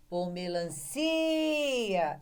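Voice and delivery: "melancia" called slowly, CI held long and high; extended CI. Shown as a demonstration. Teacher shows which syllable is stressed, calling with long CI. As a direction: stretch the CI of "melancia" like a call. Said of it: "o si que tem o som estendido".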